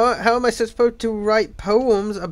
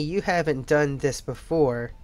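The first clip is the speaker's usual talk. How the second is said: ordinary talk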